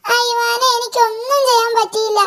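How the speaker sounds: high little devil voice, whimpering; whiny imp. Acted. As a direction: sound like a beaten goblin. Imp voice in story.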